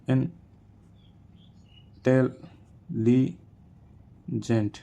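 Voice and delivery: "Intelligent" very slow, in four parts with long pauses; syllable by syllable. Shown as a demonstration. Teacher showing four syllables, "intelligent" slowly syllable by syllable. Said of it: "four syllable in intelligent".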